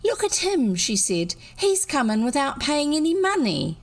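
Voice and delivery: high, indignant tattling; a girl accusing the great man, high. A narrator's reading. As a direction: point and tell on him. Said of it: "pointed an accusing finger".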